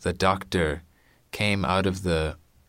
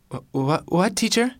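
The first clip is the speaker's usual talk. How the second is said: put-on startled child; 12 semitones higher than usual. Robin caught daydreaming.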